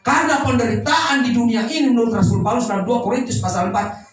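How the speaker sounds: emphatic sermon voice with punched openings; preaching. Real, not acted. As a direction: hammer out each contrast to the congregation. Preaches at full force. (khotbah tegas penuh penekanan)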